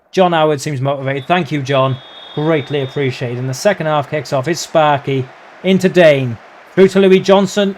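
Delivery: ordinary talk, nothing marked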